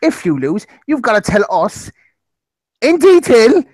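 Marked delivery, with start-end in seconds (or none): 2.8-3.6 in detail, both words high and loud; emphatic. Real, not acted. Host setting the forfeit, stressing the words in detail. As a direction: punch in detail hard. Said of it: "passion has to be given".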